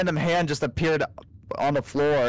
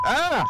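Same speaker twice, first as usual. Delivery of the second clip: startled scream; 13 semitones higher than usual. Real, not acted. Startled by zombie.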